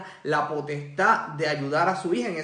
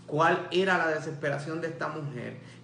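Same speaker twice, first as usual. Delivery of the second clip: ordinary talk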